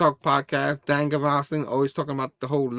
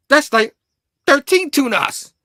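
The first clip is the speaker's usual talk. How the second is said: high, loud, stretched-out cry; triumphant exclamation; 11 semitones higher, 8 dB louder than usual. Acted. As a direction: crow it like a big reveal. Spider-Man's triumphant punchline.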